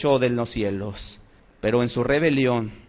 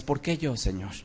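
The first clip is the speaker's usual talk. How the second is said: softer, lower, wondering "¿Por qué yo, Señor?"; hushed question; 3 semitones lower, 8 dB quieter than usual. Retold or imitated. Believer asking the Lord, hushed and wondering. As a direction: ask it under your breath. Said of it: "se han preguntado en ocasiones".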